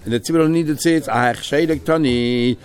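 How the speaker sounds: ordinary talk, nothing marked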